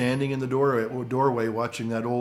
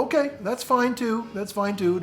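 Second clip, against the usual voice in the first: high agreeable okay; 10 semitones higher than usual. Retold or imitated. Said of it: "I'll go".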